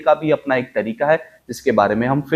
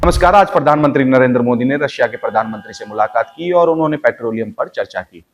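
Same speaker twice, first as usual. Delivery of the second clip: rushed, fast news bulletin; fast news read; faster than usual. Shown as a demonstration. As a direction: race through the headline. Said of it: "इसकी speed थोड़ी और बढ़ा देते हैं".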